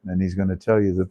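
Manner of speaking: usual speaking voice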